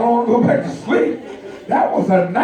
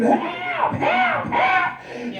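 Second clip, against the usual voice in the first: hammed-up high-pitched scream for help, much higher; 4 dB quieter, much slower than usual. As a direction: shriek help like a woman pretending to be attacked. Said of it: "she starts screaming".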